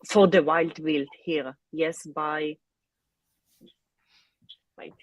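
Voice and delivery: usual speaking voice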